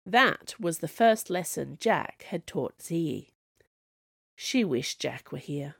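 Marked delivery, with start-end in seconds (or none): none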